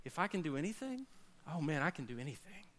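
hushed and knowing, as God answering a doubter; soft tender God voice; God's reply. Acted. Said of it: "And God's going".

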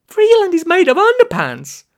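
loud, high-pitched squawk; high, loud cry of disbelief. A narrator's reading. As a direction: squawk it out to anyone who will listen. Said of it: "I squawked at anyone on the tour".

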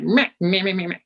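sing-song mock bleating; mocking meh. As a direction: brush off the advice with childish mock noises. Mocking her own refusal.

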